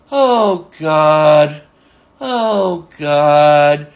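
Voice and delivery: drawn-out exasperated groan of words, imitated; exasperated coworker. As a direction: sigh it out long, dropping onto a held last word. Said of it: "things were driving him McMental".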